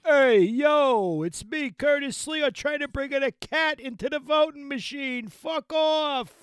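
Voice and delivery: high, loud New York loudmouth; Curtis Sliwa. Acted. Host mimics a regional New York politician, high loud shout. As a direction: yell it high like a street-corner character. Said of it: "You voted for Curtis Sliwa".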